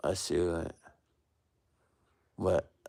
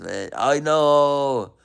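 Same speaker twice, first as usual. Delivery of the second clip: ordinary talk, nothing marked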